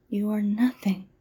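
ordinary delivery

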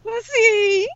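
high-pitched drawn-out group cheer; playful cheer. Pretend. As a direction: call it out like a gleeful chorus. Group calls out the theme.

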